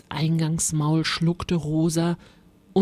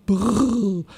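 rolled, shivering "brrr"; shuddering brr; 3 semitones higher, 4 dB louder than usual. A narrator's reading. Rosa seeing the horrible monster, a shuddering brr. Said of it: "Rosa schüttelte sich".

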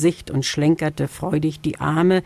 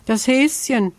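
pear tree answering; 7 semitones higher than usual. Acted. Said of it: "antwortete der Birnbaum".

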